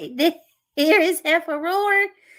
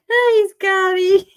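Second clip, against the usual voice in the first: laughing; 6 semitones higher, 4 dB louder, slower than usual. Real, not acted. Laughing while greeting chat.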